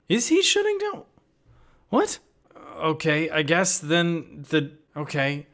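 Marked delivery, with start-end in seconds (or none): 0.1-2.2 incredulous squeak, far above usual pitch; very high, baffled voice; high-pitched disbelief. Pretend. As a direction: squeal the question in exaggerated confusion. Incredulous reaction to the clip.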